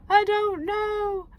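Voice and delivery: put-on high, slow sulky child voice. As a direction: drag it out like a stubborn little girl. Daughter's sulky reply voiced.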